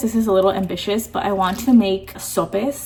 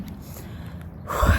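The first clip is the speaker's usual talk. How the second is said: winded exhale mid-walk; breathless sigh; 4 dB quieter than usual. Real, not acted. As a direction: sigh while catching breath. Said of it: "talk and catch my breath".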